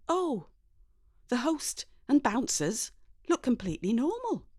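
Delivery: ordinary delivery, nothing marked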